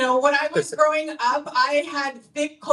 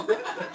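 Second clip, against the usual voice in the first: laugh; 10 semitones higher, 4 dB quieter than usual. Real, not acted. Comic laughing at audience members. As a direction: burst of high laughter.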